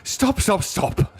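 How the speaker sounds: high-pitched, urgent plea; urgent interruption. A narrator's reading. Doctor halting the brewing fight, urgent and high. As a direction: cut in with the voice shot up high.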